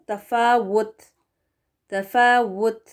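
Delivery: तफावुत with a clear w and a firm final t; correct demo. Shown as a demonstration. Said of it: "ये वाला जो दूसरा है ना".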